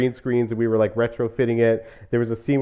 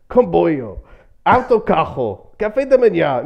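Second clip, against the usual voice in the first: put-on higher-pitched take on a Portuguese accent; imitated Portuguese accent; 6 semitones higher, 6 dB louder than usual. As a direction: mimic a strong European Portuguese accent. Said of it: "who has a really Portuguese accent".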